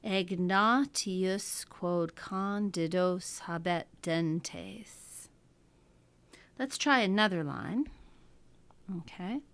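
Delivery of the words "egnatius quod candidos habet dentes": slow, syllable by syllable, marking the beats; slow scanned reading of Latin verse; metrical reading. Shown as a demonstration. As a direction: read it out to show the meter. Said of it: "and we can read it".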